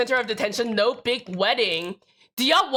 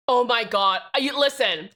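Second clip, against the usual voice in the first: usual speaking voice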